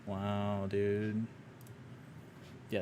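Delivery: usual speaking voice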